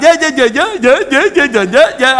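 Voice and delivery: high, rapid-fire repeated yeahs; imitated spiritual outburst. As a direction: mimic someone overcome at his baptism. Imitates man overcome at baptism.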